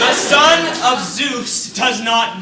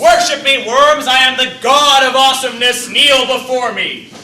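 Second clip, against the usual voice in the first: loud, high, grandiose commanding; booming boast; 7 semitones higher, 11 dB louder than usual. Acted. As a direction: proclaim yourself a god. Hercules boasting as a god.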